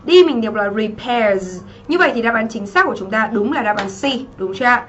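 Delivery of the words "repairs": "repairs" ending in a buzzed z; final Z sound. Shown as a demonstration. Teacher showing students the ending, final z sound on "repairs". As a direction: say "repairs" slowly with the s voiced as z. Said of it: "ờ, đọc là Z thì cô giáo viết thành sờ rồi".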